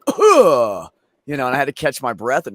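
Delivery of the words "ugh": loud pained grunt; winded grunt. Retold or imitated. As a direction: grunt as if the wind is knocked out. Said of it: "And I was like".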